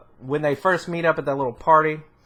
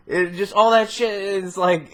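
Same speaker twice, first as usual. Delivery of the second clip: usual speaking voice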